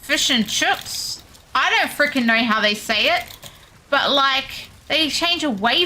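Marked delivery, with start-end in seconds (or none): 0.0-1.1 mock New Zealand accent. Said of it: "they make it like".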